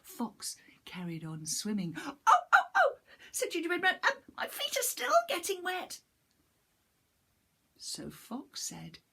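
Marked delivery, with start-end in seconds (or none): 2.0-6.0 high, squeaky, fast panicked little voice; a tiny cookie in a flap; high panicky little gingerbread man; frightened gingerbread man. Acted.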